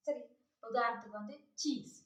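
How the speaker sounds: normal talking voice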